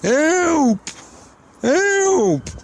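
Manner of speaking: very high, drawn-out mock call for help; imitated cry for help. Old fella propped on a pillar calling for help, high mock wail. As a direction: put on a high, wailing old man's "help". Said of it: "if I was to do an impression of it".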